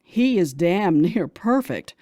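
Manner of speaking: ordinary delivery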